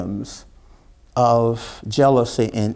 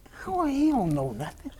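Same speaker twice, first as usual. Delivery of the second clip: hushed whisper, pitched higher; whispering brothers; 10 semitones higher, 8 dB quieter than usual. Retold or imitated. Brothers muttering about their baby brother, whispered. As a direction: gossip it under the breath. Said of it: "that's what they saying to each other".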